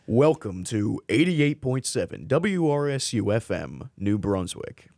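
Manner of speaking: slow, projected radio welcome; measured radio ID read; announcer voice. Shown as a demonstration. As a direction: turn on the station announcer. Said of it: "I could definitely turn it on".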